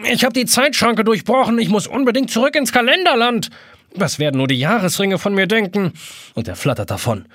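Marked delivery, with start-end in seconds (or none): 0.1-5.9 hohe, laute, fremde Kalenderstimme; panischer Kalender. A narrator's reading. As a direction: heul es panisch auf. Panischer Kalender flattert davon. (panischer Kalender, hoch und laut)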